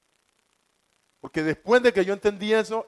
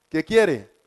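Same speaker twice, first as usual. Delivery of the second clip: ordinary talk, nothing marked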